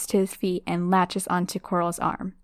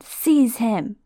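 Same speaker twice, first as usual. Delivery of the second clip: mild screamed order; 3 semitones higher, 4 dB louder than usual. Acted. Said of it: "Voldemort screamed".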